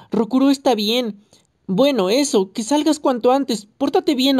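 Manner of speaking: mild, insistent yelling; shouting. A narrator's reading. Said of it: "no hacía más que gritar".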